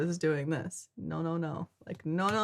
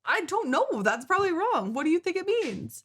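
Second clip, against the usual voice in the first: high squeaky voice; 11 semitones higher, 3 dB louder than usual. Pretend.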